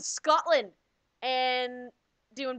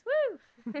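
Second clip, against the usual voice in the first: excited whoop; 11 semitones higher than usual. Real, not acted. Cheering about hot Spartans.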